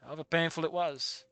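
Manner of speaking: quiet, even reading of the story text; story narration. A narrator's reading. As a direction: read the passage softly, as the narrator. Reads the game's narration.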